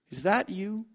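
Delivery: soft, tentative asking; hushed question. Acted. Woman tentatively asking the stranger if he's Messiah, hushed. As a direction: ask it barely daring to hope.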